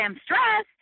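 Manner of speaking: mimicking the casual way people say they're stressed; mock breezy stressed. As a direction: toss it off in a high chirpy voice like everyone does. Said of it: "So we say".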